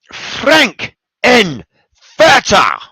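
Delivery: high, punched, word by word reveal; dramatic announcement. Real, not acted. Announces his honorable mention pick.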